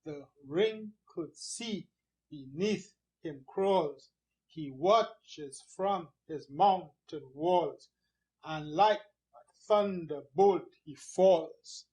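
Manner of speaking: slow, measured beat on "sea", "crawls", "walls", "falls"; rhythmic verse reading. Shown as a demonstration. Teacher trying the rhythm on this one, slow stress on "crawls", "walls", "falls". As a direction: recite slowly in metre, landing on "crawls", "walls", "falls". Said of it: "So there's a rhythm there".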